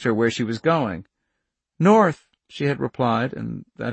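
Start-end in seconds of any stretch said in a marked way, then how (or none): 1.8-2.3 put-on a high, loud girl's one-word answer; young girl. Charlie answers the hippies, high and loud. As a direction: voice Charlie calling out north.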